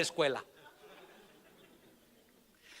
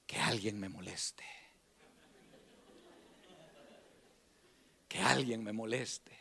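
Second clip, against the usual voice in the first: slow whispered dare to himself; 11 semitones lower, 12 dB quieter, slower than usual. Retold or imitated. Boy daring bullies under his breath, slow whisper.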